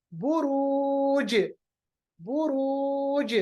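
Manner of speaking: very slow बुरूज, long oo and bounced j; qalqalah. Shown as a demonstration. Teacher stops on jeem, drawn-out बुरूज with bouncing j. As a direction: hold बुरूज, then echo the j as "burooje". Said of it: "कैसे पढ़ेंगे?".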